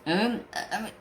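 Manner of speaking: ordinary talk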